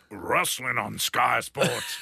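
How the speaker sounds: slow hammed-up Sky Sports promo; mock TV announcer read; wrestling promo. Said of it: "they'd always go".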